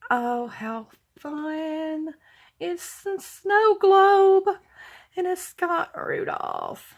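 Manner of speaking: slow, tender cooing. Real, not acted. Cooing over snow globe.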